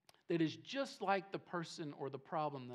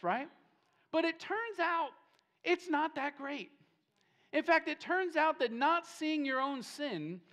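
high, raised preacher's voice; impassioned preaching; 8 semitones higher, 6 dB louder than usual. Real, not acted. Preacher exposing self-righteousness to the congregation, impassioned. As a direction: preach it emphatically, pitched well up. Preacher presses his point.

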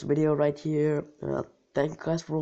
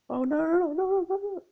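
panicked; 13 semitones higher, faster than usual. Real, not acted. Panics as something goes wrong.

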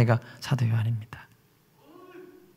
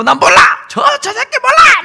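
cursing shout; 6 dB louder than usual. Acted. Peter cursing denial.

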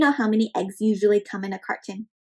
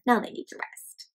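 ordinary delivery

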